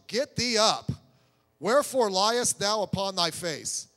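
loud, stern words of the Lord; commanding scripture reading. Acted. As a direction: read God's command out with force. Reads God's command to Joshua.

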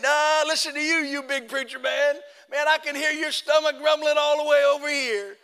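high, taunting devil voice; mocking devil. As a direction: jeer like a devil needling a preacher. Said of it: "He's mocking me and making fun of me".

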